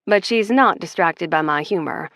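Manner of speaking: usual speaking voice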